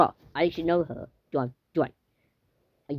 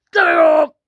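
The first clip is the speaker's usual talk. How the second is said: very high, loud mock yell; pretend shouted order; 13 semitones higher, 8 dB louder than usual. Ordering someone up in play, high shout.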